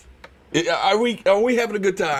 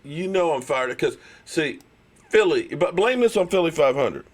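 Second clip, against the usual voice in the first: ordinary talk, nothing marked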